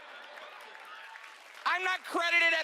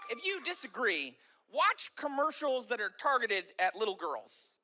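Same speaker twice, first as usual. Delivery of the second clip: ordinary talk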